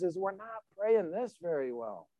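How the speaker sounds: ordinary talk, nothing marked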